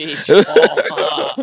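high laughing echo of the phrase; laughing repeat. Real, not acted. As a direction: say it while cracking up, pitched high. Repeating funny word laughing.

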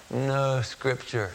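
barely getting the words out, slow; a dying man's weak, slow reply. Retold or imitated. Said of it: "he said in a weak voice".

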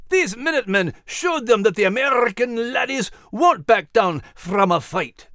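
proud Scottish lilt for Max the dog; Scottish-accented dog. Acted. Max the dog boasts to Clarice, Scottish brogue.

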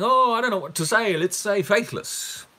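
high-pitched imitation of casual guessing; mock cluelessness. Preacher imitating a Jesus with no idea, mock offhand. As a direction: act out making it up on the spot.

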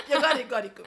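loud, laughing mock insistence; stubborn insistence. Crushing her own doubt with pride, loud and laughing. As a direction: push your pride through. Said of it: "プライド？…押しつぶすみたいな".

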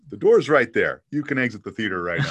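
loud, mock stern pointing to the exit; playful loud mock dismissal; mock eviction. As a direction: throw the Boston fan out as a joke. Joking to the booing crowd.

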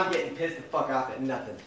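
normal talking voice

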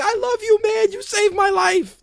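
high, loud, gushing mock quote; gushing Heyman. Mocking Heyman's gushing words to Ryback. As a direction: ham up the lovestruck wrestler. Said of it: "he was like".

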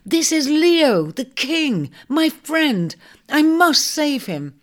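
high, emphatic plea for a friend; indignant cry. Acted. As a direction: defend your friend, pitched high and slow. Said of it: "Ares cried indignantly".

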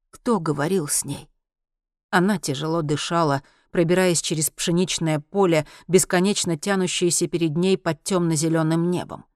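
plain reading voice

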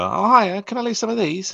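very high, chirpy acted greeting; high-voiced mock customer politeness; friendly request. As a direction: pitch it right up, politely asking a favour. Acts out asking in takeaway.

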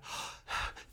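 breathy quiet gasp in awe; small awed intake of breath; hushed gasp. Retold or imitated. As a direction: gasp softly at a discovery. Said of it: "He went".

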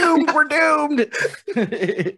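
high-pitched panicky droid impression; C-3PO. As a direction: wail it high like a doomsaying robot. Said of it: "I was going with C three P O".

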